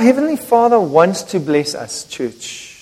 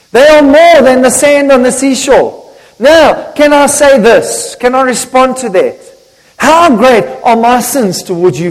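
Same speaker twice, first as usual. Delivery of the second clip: raised, louder, emphatic pulpit delivery; impassioned preaching; 6 semitones higher, 5 dB louder than usual. Acted. Preacher confessing to the church how many sins we have, impassioned preaching. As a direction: drive it home to the congregation.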